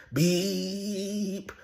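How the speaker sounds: high drawn-out beep; mock car horn beep. Man retelling traffic row imitates a horn beeping. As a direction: sing out a long high beep.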